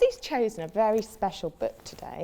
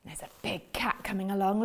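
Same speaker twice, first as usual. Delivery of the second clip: ordinary delivery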